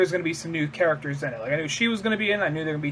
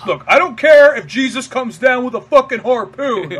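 higher, louder defiant outburst; defiant rant; 6 semitones higher, 8 dB louder than usual. Retold or imitated. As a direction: dig in your heels and rant. Stubborn resident ranting.

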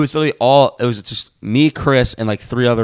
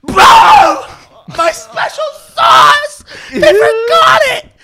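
very high, loud mock tantrum shriek; hammed-up wailing scream, words stretched out; freaking out; 9 dB louder, much slower than usual. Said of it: "freaks the fuck out".

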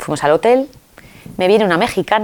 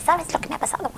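excited Mexican woman; 10 semitones higher, 8 dB quieter, faster than usual. Retold or imitated. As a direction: call out to her friend, thrilled to share. Imita a la mexicana. (la mexicana, muy aguda y emocionada, llamando a Inma)

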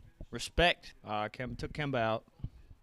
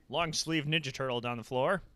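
normal talking voice

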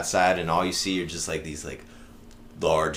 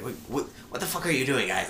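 higher, fast, stammered demand; startled head scout; 7 semitones higher, faster than usual. Retold or imitated. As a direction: imitate a baffled leader catching them out. Head scout confronts the kidnappers.

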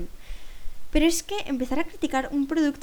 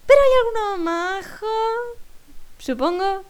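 high, drawn-out grudging concession; 9 semitones higher, 5 dB louder than usual. Real, not acted. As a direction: concede it reluctantly in a raised voice. Concede a regañadientes tras su confesión. (concesión a regañadientes)

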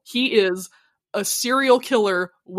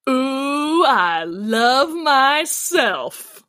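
very slow, drawn-out smug purr; smug gloat; much slower than usual. Retold or imitated. Sydney steals Garrett's catchphrase to his face, smug and slow. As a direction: savor every word, pure self-love.

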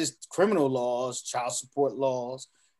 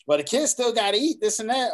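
mocking quote; 6 semitones higher, 6 dB louder than usual. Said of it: "They say".

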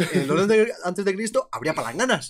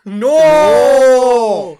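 high, loud cry of shock; 6 semitones higher, 11 dB louder than usual. Real, not acted. Hearing news of the Manchester concert, a loud shocked no. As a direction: blurt it out in real dismay.

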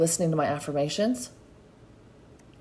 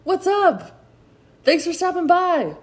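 bright, much higher welcome to a walk-in customer; friendly shop greeting; 11 semitones higher than usual. Pretend. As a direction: greet them like a cheery store clerk at the door. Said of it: "tell people when they walk into your storefront".

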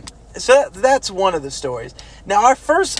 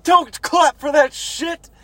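drill sergeant shout; 10 semitones higher, slower than usual. Retold or imitated. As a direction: shut the recruits down like a drill sergeant. Drill sergeant silences clapping recruits.

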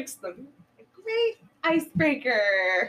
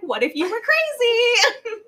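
manic question; 9 semitones higher, 7 dB louder than usual. Retold or imitated. Missy justifying her knife.

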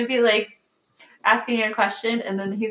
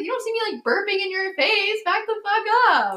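high sassy telling-off; 9 semitones higher than usual. Retold or imitated. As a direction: tell him off, high and sassy. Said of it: "I was like".

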